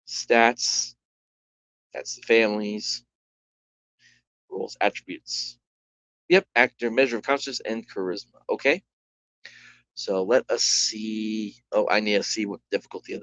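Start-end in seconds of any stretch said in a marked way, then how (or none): none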